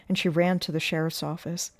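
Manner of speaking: usual speaking voice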